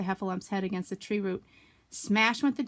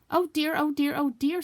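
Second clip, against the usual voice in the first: higher, louder fretful oh dear; worried Piglet; 6 semitones higher, 5 dB louder than usual. Acted. Little Piglet frightened near the trap. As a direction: fret like a small frightened pig. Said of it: "said Piglet to himself".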